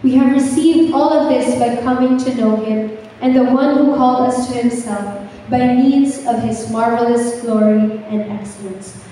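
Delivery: ordinary delivery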